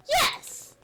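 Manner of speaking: excited. Acted. Rivals shout.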